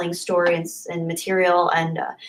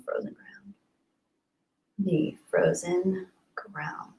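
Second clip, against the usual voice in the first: whispering; 3 semitones lower, 11 dB quieter, slower than usual. Real, not acted. Whispering title to herself.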